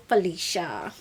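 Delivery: ordinary talk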